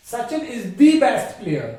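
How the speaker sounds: slow, 'the' as a long 'dee' before 'best'; the said as dee. Shown as a demonstration. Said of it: "टीएचई का उच्चारण होगा वो क्या होगा".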